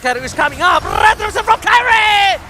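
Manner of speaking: rising hype, loud and drawn out; fighting game announcer. Real, not acted. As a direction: shout the steal like a caster. Esports casters, Turtle steal hype.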